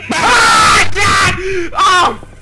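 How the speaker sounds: loud, startled scream; real scream of fright. Real, not acted. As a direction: scream out as the scare hits. Sudden jump scare.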